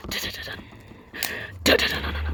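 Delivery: unvoiced thudding rhythm; whispered drumbeat footsteps. A narrator's reading. Narrator building suspense as the giant runs up, whispered drumbeat. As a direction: whisper the giant's stomping beat.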